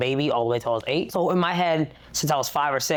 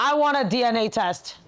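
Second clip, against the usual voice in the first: high, insistent mock demand; retold indignant demand; 9 semitones higher than usual. Sister doubting the new brother, high mock-indignant demand. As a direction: act out a protesting kid, pitched up.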